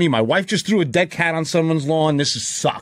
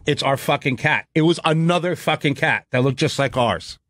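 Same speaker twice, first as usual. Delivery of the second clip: ordinary talk, nothing marked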